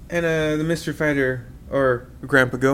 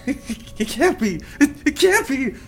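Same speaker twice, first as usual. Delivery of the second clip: mock disbelief; 10 semitones higher, slower than usual. Shocked recognizing grandpa.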